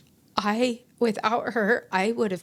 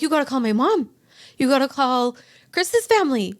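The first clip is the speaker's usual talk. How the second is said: louder, higher desperate orders; retold panic, raised and urgent; frantic pleading; 5 semitones higher, 7 dB louder than usual. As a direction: push the words out in panic. Panicked instructions in the car.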